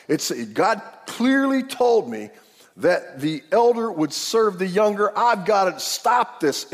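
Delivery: high, slow, determined mock protest; emphatic insistence. Mother set on saving God's plan, emphatic insistence. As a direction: insist on it, stretching the key words. Rebekah argues her side.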